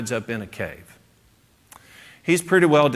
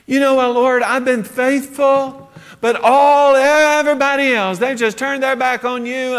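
higher, louder whining lament; despairing complaint; 11 semitones higher, 6 dB louder than usual. Retold or imitated. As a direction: pour out self-pity, hammed-up. Elijah's despairing complaint to God.

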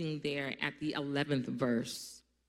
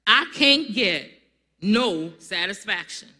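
louder, punchier second take of the title; louder repeat; 9 dB louder than usual. Shown as a demonstration. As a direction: call it out for the crowd. Repeats title after laugh.

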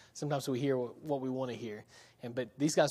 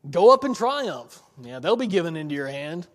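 heavy sarcasm; 8 dB louder than usual. Shown as a demonstration. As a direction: overplay the sarcasm so nobody misses it. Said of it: "Let's try again".

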